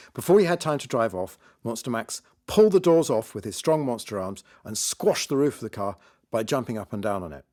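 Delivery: ordinary delivery, nothing marked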